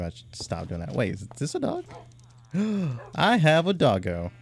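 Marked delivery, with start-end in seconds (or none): 2.5-3.1 sharp high gasp of delight. Real, not acted. Gamer spots a dog in his game, delighted gasp. 3.2-4.3 loud, high, delighted; thrilled to find a pet; excited. Real, not acted. Gamer tells viewers about his new dog, thrilled.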